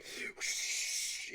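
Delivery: airy, helpless laughter; breathless laugh. Real, not acted. Recalling spraying the extinguisher, real helpless laughter. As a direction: laugh at how dumb it was.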